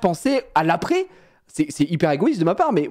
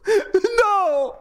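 high, slow, hammed-up cry of no; wife wailing at Steph's news, a high hammed-up cry; mock wail; 12 semitones higher, much slower than usual.

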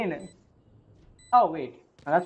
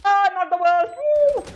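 loud, high whoop of relief; excited cheer; 9 semitones higher, 4 dB louder than usual. Real, not acted. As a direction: celebrate it out loud. Celebrating a good round.

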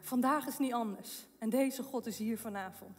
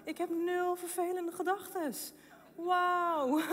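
joyful amazement; 4 semitones higher than usual. Retold or imitated. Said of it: "dacht ik".

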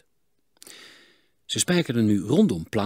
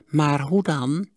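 put-on miserly old voice, a bit higher; stingy old man; 6 semitones higher, 4 dB louder, slower than usual. A narrator's reading. Miser haggles with the smith, stingy old voice. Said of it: "stille hoop".

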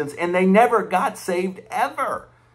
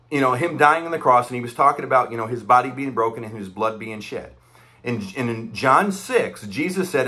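ordinary talk

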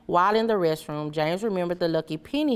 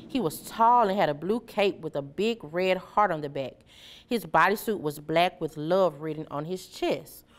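ordinary delivery, nothing marked

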